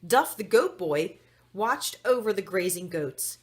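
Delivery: ordinary delivery, nothing marked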